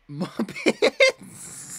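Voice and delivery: high, loud yelp of Muppets!; shouting Muppets! in delight; excited shout. Real, not acted. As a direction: blurt it out in sudden glee. Exploding about the Muppets.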